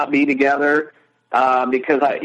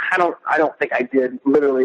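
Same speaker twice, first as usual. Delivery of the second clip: ordinary delivery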